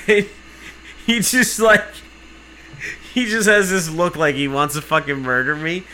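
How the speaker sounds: normal talking voice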